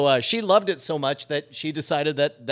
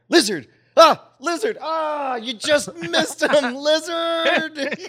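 put-on high, loud, excited toddler cries; three-year-old daughter; 11 semitones higher, 5 dB louder, slower than usual. Daughter caught in the wrecked bathroom blaming the lizard, high toddler voice. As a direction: squeal it out like a tiny kid, stretching the last words. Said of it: "my three-year-old daughter going".

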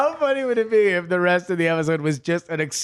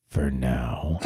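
much lower, soft and menacing; ominous threat; 7 dB quieter than usual. Acted. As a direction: drop into a dark warning.

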